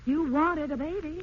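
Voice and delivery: wife's slow, hurt retort in a quarrel; pointed retort. Acted. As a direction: throw it back at him slowly. Marital quarrel, wife retorts.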